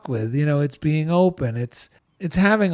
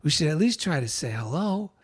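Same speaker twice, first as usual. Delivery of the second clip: hushed aside; 7 dB quieter, faster than usual. Retold or imitated. Nervously asking wife to approach president.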